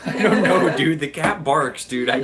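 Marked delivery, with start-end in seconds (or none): 0.1-0.8 laughing, high and loud. Real, not acted. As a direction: crack up while talking. Cracking up over cat.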